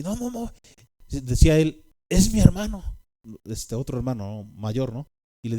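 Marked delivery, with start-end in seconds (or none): 0.0-0.5 scared calming. Retold or imitated. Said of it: "estaba una voz espantada". 2.1-2.8 higher and louder frightened insisting; scared reassurance. Retold or imitated. Older brother calming little brother, higher frightened voice. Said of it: "estaba una voz espantada".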